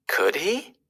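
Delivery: usual speaking voice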